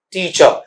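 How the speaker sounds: टीचर as slow "tee-chuh", no final r; corrected pronunciation. Shown as a demonstration. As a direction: stretch टीचर and let the end soften to a schwa. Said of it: "ऐसा आएगा।".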